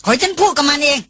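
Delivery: calling out. A narrator's reading. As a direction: Darin begs the group to hold still. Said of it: "ดารินร้องสุดเสียงอยู่เช่นนั้น". (ร้องบอกเสียงสูง เร่งร้อน)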